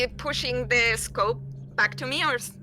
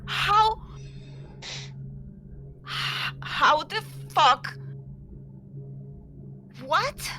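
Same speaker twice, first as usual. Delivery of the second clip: stunned disbelief; 6 semitones higher, slower than usual. Acted. Player stunned.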